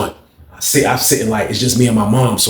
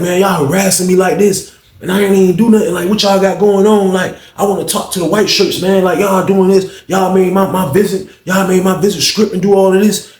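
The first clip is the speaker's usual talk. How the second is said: angry complaint; 7 semitones higher than usual. Retold or imitated. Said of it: "he snapped out about it".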